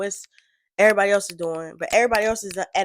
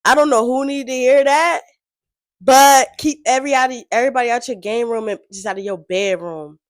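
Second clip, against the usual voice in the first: louder and higher, fired up; hyped agreement; 4 semitones higher, 11 dB louder than usual. Real, not acted. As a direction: preach it like the lyric just hit you.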